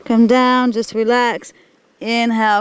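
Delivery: usual speaking voice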